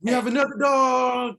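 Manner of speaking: loud high shout; loud announcement of another dog. Real, not acted. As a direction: call out over the noise.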